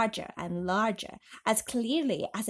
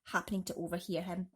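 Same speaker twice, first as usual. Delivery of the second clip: ordinary delivery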